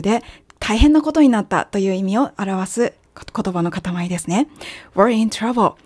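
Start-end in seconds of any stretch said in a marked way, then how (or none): none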